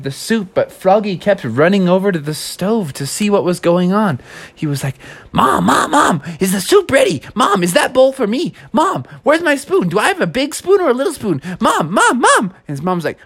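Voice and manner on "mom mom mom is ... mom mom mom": put-on pestering kid. Impatient kid pestering mom.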